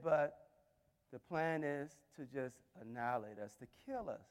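low, hushed words with long pauses; halting, very soft appeal to the king; quiet plea. Acted. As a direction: let each word fall softly, with gaps. Esther pleading with the king.

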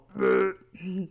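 loud gagging retch; vomit noise. Retold or imitated. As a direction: fake a gross-out gag. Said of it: "that's my vomit noise".

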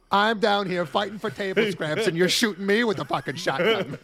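high, hammed-up complaint; mock angry whine. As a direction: whine like a cornered thug. Voicing Silco complaining.

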